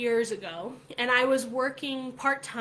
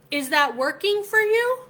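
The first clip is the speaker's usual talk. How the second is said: higher, louder, pointed rising question; loud challenge; 9 semitones higher, 8 dB louder than usual. Retold or imitated. Pressing the coworker about skipping the party, loud and incredulous. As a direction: call him out, loud and incredulous.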